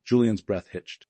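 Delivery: usual speaking voice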